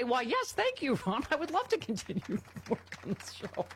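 high-pitched, gushing mock politeness; mock gracious acceptance. Eager replacement host thanking the boss over a dead body, mock gracious.

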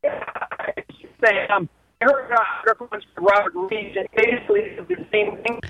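caller on a bad phone line; muffled speakerphone audio; phone speaker. Real, not acted. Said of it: "we can't hear you super well".

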